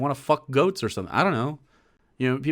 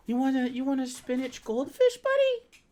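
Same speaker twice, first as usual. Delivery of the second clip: very high sing-song offer; 10 semitones higher than usual. Real, not acted. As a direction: coax the puppy with a cooing voice. Offering dog a treat.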